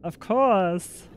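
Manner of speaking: "Of course" sung out high with a falling "course"; high voiced. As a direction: lift "Of course" high and bright. Repeats phrase two ways.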